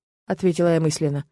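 ordinary delivery, nothing marked

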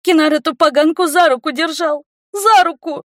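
high tearful whine; snotty crying. Acted. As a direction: wail it, near sobbing. Tearful girl complaining.

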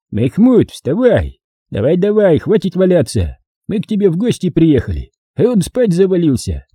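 high-pitched commander rousing someone; бодрое понукание. A narrator's reading. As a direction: chivvy him up off the ground. Командир поднимает лежащего хозяина. (понукать повышенным голосом)

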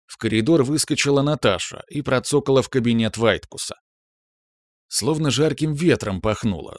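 ordinary delivery, nothing marked

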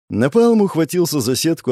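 ordinary delivery, nothing marked

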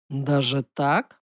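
low, slow, ominous question; menacing amusement. Acted. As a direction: draw it out low with an unkind smirk. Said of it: "Эрдан нехорошо усмехнулся". (низко и медленно, с недоброй усмешкой)

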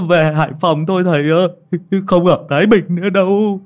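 high, loud, pleading in terror; trembling plea. Acted. Lâm Sẹo begging the ghost woman to spare him, trembling plea. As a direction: beg for your life, shaking. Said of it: "run rẩy".

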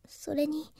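unvoiced hush, half to herself; soft whispered aside. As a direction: breathe the word like a secret. Girl confides at bedside.

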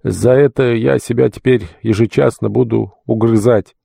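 a touch of tearful remorse, voice lifted; tearful babble. A narrator's reading. Deacon repents to the archpriest, understated tearful lament. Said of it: "и горько заплакал, лепеча".

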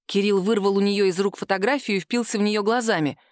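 usual speaking voice

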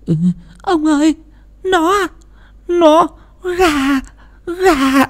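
high, stammering, terrified wife voice; trembling stammer. A narrator's reading. Frightened wife stammering to her husband about the chickens, high and shaky. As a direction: stutter it out in fright, words breaking apart. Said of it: "Bà Thủy run rẩy lắp bắp".

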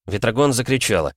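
plain reading voice

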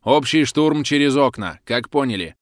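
radio command. Acted. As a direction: bark the assault order down the radio. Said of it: "прошипела рация голосом Белкина".

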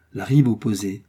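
ordinary delivery

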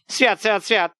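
high, lamenting "свят, свят"; a frightened woman crossing herself; wailing prayer. A narrator's reading. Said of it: "запричитала та". (причитание)

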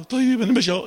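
mock-meek letter going along; meek weak letter. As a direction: play the feeble neighbour meekly caving. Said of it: "اللي جنبه هزيل".

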